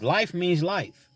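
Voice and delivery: slow and high, each word weighted; emphatic slow warning. Real, not acted. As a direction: let life means life land hard. Warning young men about prison.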